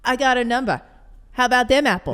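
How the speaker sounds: Boston-tinged, punchy movie line; New England accent. Acted. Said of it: "not a bad New, New England accent".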